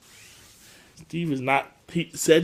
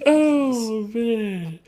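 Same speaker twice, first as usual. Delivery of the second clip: ordinary talk, nothing marked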